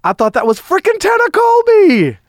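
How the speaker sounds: amused outburst. Real, not acted. Surprised joking outburst.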